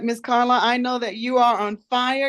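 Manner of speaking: ordinary delivery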